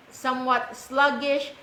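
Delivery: ordinary talk, nothing marked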